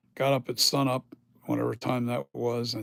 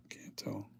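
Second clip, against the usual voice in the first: whispered aside; 3 semitones lower, 12 dB quieter than usual. Real, not acted. Squinting at own stock, unsure.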